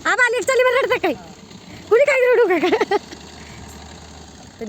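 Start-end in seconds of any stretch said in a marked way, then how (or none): 0.0-3.0 very high, called-out teasing; playful teasing. Real, not acted. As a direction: tease the departing guests in a high voice. Teasing relatives while seeing them off. (उंच आवाजात चिडवत)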